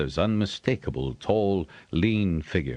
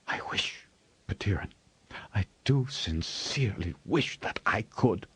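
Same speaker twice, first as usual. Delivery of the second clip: hushed bitter reply; 3 semitones higher, 4 dB quieter than usual. A narrator's reading.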